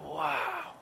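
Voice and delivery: very soft, long-drawn wow; awed wow. Retold or imitated. Congregation after the sermon ends, stunned hushed awe. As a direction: breathe it out in hushed wonder. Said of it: "And we're like".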